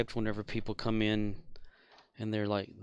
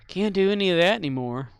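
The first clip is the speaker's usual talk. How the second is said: loud lament; 8 semitones higher, 10 dB louder than usual. Real, not acted. Lamenting lost hobby.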